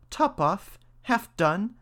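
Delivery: high mouse voice; mouse character. A narrator's reading. Mouse mulls the godchildren's names, high-pitched. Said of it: "answered the mouse".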